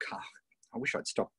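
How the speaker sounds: under his breath, to himself; whispered aside. Real, not acted. Coach scolding himself in a whisper about his accent. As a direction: drop to a whisper for a private grumble.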